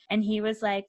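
ordinary talk, nothing marked

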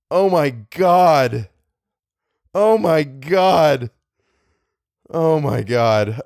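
slow dread. Real, not acted. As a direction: stretch each word out in slow despair. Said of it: "I don't wanna do it".

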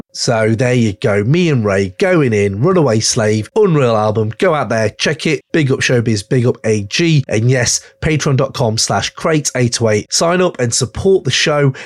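host signing off, plugging the album and Patreon; podcast outro plug. Acted. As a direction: wrap it up like a host plugging the show.

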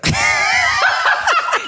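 loud, high-pitched cackling laugh; burst of laughter. Real, not acted. Laughs at the misheard word.

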